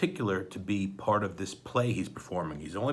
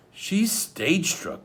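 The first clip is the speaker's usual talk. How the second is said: put-on very slow, higher boy voice; a boy's slow resigned verdict; stage struck; 9 semitones higher, 5 dB louder, much slower than usual. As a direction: drag it out in dismay. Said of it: "Klaus said".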